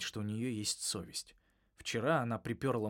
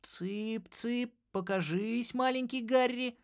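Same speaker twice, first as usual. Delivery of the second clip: much higher coaxing sing-song; coaxing baby talk; 12 semitones higher, slower than usual. A narrator's reading. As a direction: coax it out like calling chicks. Coaxing polyp out.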